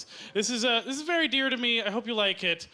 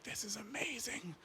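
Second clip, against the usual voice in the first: very soft, hushed bliss; awed whisper; 5 semitones higher, 11 dB quieter than usual. Acted. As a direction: whisper it in mock rapture. Acting bliss at first lobster bite.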